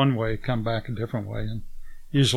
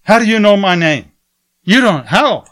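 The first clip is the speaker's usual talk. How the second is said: much higher and louder, alarmed disbelief; shocked outburst; 8 semitones higher, 12 dB louder than usual. Retold or imitated. Guard panicking that the traveller knows his name, shocked shout.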